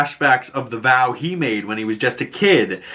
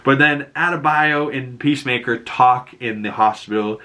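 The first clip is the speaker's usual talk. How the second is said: ordinary talk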